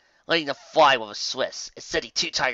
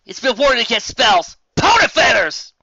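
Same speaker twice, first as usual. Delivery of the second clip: loud, high exasperated cursing; loud high frustrated oath; frustrated shout; 10 semitones higher, 9 dB louder than usual. Acted. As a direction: curse the lock. Frustrated mage swears.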